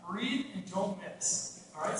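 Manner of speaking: ordinary talk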